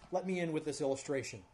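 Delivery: usual speaking voice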